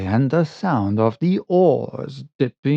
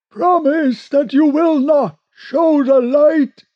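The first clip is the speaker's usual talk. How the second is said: unseen voice pleading; 13 semitones higher, 4 dB louder than usual. Acted. Hidden boatman begs the sailors, high plaintive call. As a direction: plead across the water in high sustained tones.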